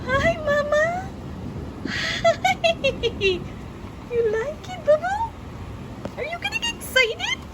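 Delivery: put-on high, sing-song baby voice; baby talk. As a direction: coo at the little one like a parent. Baby talk to Milo during storm.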